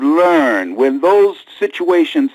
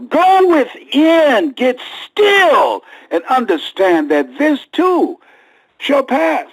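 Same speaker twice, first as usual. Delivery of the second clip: slow soaring preaching; 7 semitones higher, slower than usual. Acted.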